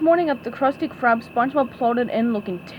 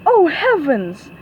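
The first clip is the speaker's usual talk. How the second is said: slow, louder alarmed cry; cried out; 4 semitones higher, 7 dB louder, slower than usual. A narrator's reading. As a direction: gasp it out in alarm. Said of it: "she cried".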